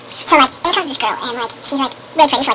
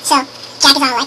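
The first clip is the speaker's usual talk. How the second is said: usual speaking voice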